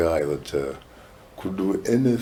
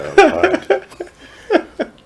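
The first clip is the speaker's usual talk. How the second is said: laughing; 8 dB louder than usual. Real, not acted. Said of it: "laughing mid-sentence".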